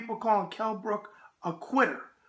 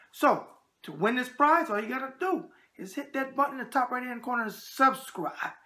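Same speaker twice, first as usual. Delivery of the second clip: normal talking voice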